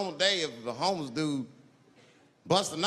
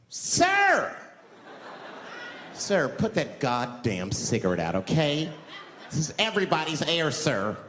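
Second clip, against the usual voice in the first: a put-on scolding passenger, slow and insistent; indignant passenger; 2 semitones lower, slower than usual. A bus passenger scolding the smoker, indignant put-on voice. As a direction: play the outraged rider telling him off.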